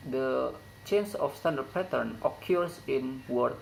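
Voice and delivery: normal talking voice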